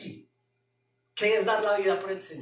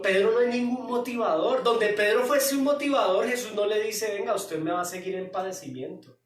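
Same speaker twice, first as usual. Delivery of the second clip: loud, driving sermon delivery; fiery preaching; 5 semitones higher, 5 dB louder than usual. Acted. Pastor preaching at full force to the church. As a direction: preach it hard to the congregation.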